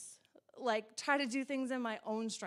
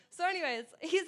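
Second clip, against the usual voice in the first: ordinary talk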